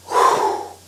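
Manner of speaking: big breathy exhale of relief; relieved sigh. Acted. Harry finds the stroller still there, relieved sigh. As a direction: blow out a deep breath. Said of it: "sighed and took a deep breath".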